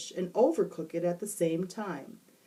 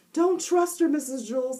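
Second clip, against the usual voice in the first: put-on high, loud boy warning; urgent warning; 8 semitones higher, 6 dB louder than usual. A narrator's reading. Upside-down boy warning the teacher, urgent. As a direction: warn her urgently. Jason's voice.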